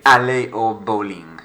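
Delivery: aller au bowling in careful French, bowling said the French way; French pronunciation. Shown as a demonstration. Teacher models French phrase for pupils, careful clear pronunciation. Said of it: "we've got to French it up".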